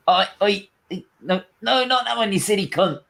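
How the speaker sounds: high, strained put-on lout; tyre-slasher voice. Lads slashing the wrong bus tyres, high put-on voice. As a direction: scold your clueless accomplice in a high voice. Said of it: "What's that voice you did".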